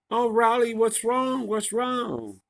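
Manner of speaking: high, gushing mock concern. Georgia fussing over crying Riley, in a mocking high concerned voice.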